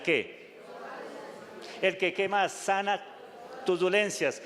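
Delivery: fervent pulpit exhortation; preaching at full force. Acted. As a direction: press the point home to the flock. Preacher calling out psalm verses.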